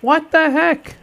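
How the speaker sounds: high, loud disbelieving cry; surprised outburst. Real, not acted. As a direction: react to the bad news. Enemy rolls a critical hit.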